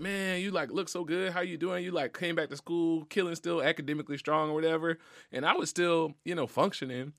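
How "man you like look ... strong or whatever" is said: lightly mimicked higher voices of classmates; people at school. Mourning son imitating schoolmates, higher voice. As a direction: imitate people telling him he looks good. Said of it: "and everybody's like".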